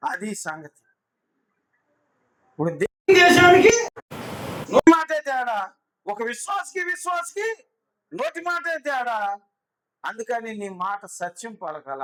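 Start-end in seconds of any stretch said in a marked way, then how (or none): 2.5-9.4 loud, high preaching burst; fiery preaching. Acted. Pastor warning congregation about careless words, shouting sermon. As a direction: thunder the point at the congregation.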